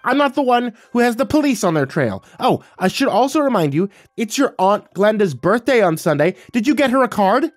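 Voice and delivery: loud, high-pitched scolding mother; shrieky nagging mom retort; shot back. Acted. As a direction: scold him at full pitch. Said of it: "She shot back".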